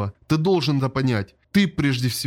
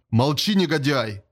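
usual speaking voice

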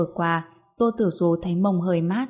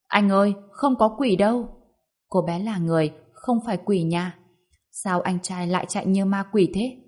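ordinary delivery, nothing marked

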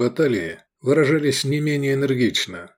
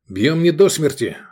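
raised-pitch order to the men; quick command; 6 semitones higher, 4 dB louder than usual. A narrator's reading. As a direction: call it out as a battle order. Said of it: "быстро скомандовал Лобанов". (командный окрик повыше)

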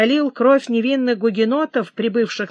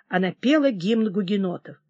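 plain reading voice

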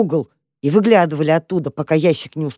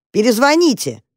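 raised, irritated command; barked order; 6 semitones higher than usual. A narrator's reading. Man in the box ends the call, barking. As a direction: bark it before hanging up. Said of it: "рявкнул последний раз".

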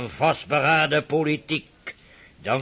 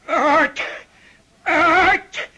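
put-on higher, bellowing old man ordering someone out; furious shout; 10 semitones higher, much slower than usual. Old prince driving the doctor from his house, furious shouting. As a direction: throw him out with rage, the last words dragged out slowly.

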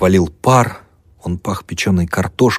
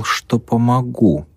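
slow, higher stern reminder; 6 semitones higher, slower than usual. A narrator's reading. Mavra reminding the student of his promise, stern. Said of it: "сурово напомнила Мавра".

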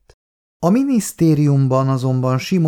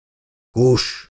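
short, mildly raised command; mild yell. A narrator's reading. Drunken father silences his son, mild narrated yell. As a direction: silence the child with one curt word. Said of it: "ordította Zsiga".

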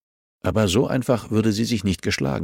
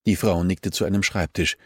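ordinary delivery

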